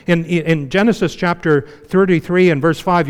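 ordinary delivery

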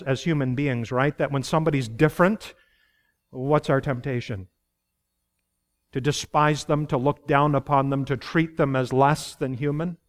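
normal talking voice

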